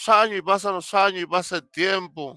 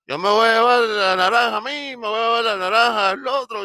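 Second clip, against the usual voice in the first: lightly higher helper's voice; the helper decides, a touch higher; voiced helper; 5 semitones higher than usual. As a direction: play the helper claiming the oranges. Helper's voice in story.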